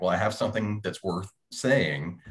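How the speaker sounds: usual speaking voice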